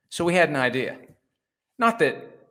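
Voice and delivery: normal talking voice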